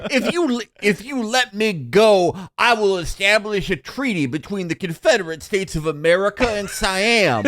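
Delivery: high-pitched, hammed-up Southern gentleman; theatrical high southern accent; mock southern accent. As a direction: plead grandly as a cartoon southern colonel. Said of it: "have a southern accent".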